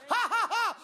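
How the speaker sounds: high, loud ha-ha-ha; punched-out forced laugh; defiant mock laugh. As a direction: laugh in the devil's face. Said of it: "just by saying".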